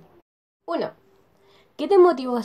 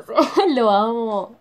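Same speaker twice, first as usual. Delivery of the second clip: giggly gush; 3 semitones higher, 8 dB louder, much slower than usual. Real, not acted. Gushing about her favourite youtuber.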